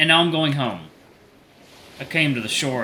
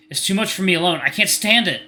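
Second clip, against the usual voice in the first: overwhelmed panic; 7 semitones higher, 4 dB louder than usual. Acted. Overwhelmed in the dream.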